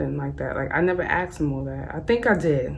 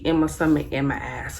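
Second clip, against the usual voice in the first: usual speaking voice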